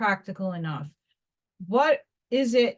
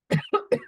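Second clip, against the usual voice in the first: sharp choking cough after water goes down the wrong way; 12 semitones higher than usual. Real, not acted. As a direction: cough hard on a swallow of water. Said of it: "Water went down the wrong pipe".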